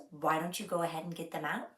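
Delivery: ordinary delivery, nothing marked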